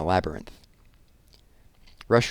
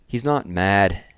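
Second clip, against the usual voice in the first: ordinary delivery, nothing marked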